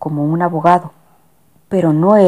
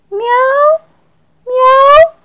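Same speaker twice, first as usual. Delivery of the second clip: very high, loud meowing; cat meow; 6 dB louder than usual. Acted. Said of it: "hizo de nuevo el gato".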